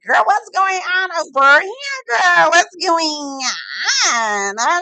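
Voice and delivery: high, loud, drawn-out mock wail; loud nosy guests. Guests hungry for gossip, hammed-up nosy wail. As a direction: stretch it out in a hammed-up high holler.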